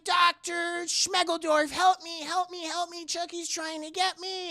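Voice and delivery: high, whiny mock cry for help; pleading child imitation. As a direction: imitate a frightened boy begging the doctor. Andy calls doctor for help.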